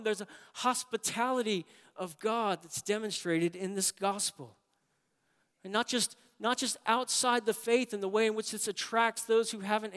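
put-on preacher recalling his childhood home, fervent; fervent preaching. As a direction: keep the preaching lifted and strong. Continued sermon fervour.

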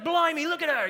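high, excited mock Australian exclamation; hammed-up Aussie wildlife host accent; terrible Australian accent. Preacher imitating the crocodile guy for his congregation, bad Australian accent. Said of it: "That's a terrible accent".

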